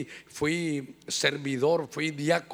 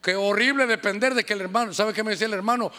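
ordinary talk, nothing marked

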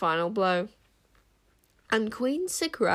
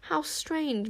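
higher-pitched, knowing wonder; pointed musing; 7 semitones higher, 3 dB quieter than usual. A narrator's reading. Enchanter questioning the queen's hypocrisy, pointedly musing. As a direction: muse aloud with a sceptical edge.

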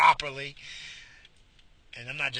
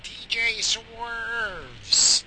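DJ announcer; 9 semitones higher, 5 dB louder, much slower than usual. Pretend. Announcing a DJ name.

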